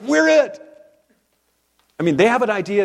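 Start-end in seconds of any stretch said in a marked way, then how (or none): 0.0-0.6 excited disciples. Retold or imitated. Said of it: "They're like".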